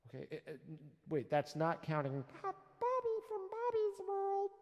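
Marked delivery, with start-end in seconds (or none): 2.4-4.5 very high little cartoon-kid voice; Bobby. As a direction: squeak it out far above your own voice. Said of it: "Remember Bobby's World?".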